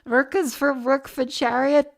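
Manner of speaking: high, slow puzzled repeat; baffled, drawn-out question; confused disbelief. Real, not acted. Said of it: "Now I'm more confused".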